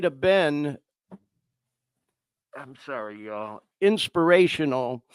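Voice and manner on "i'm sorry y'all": much softer, nearly whispered through tears; apologizing while fighting tears; tearful apology. Real, not acted. Host apologizing to viewers, tearful and much softer. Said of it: "that I'm getting emotional".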